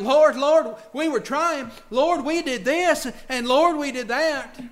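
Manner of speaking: loud, high, desperate begging; pleading cry. Acted. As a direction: plead your case, voice straining upward. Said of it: "they're crying out".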